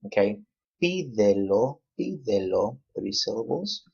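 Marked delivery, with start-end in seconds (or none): 0.8-2.8 pídelo with a lifted, stretched PÍ; careful pídelo, accent on third-to-last syllable; stress on PÍ. Shown as a demonstration. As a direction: lean into the first syllable of pídelo. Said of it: "pi is the last. We're gonna put an accent".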